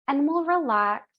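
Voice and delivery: ordinary talk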